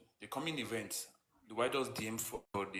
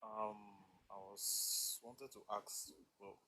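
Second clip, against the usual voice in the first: far too soft to hear; barely audible; 10 dB quieter, slower than usual. Real, not acted. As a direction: voice fading almost to nothing. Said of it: "Your voice is very low".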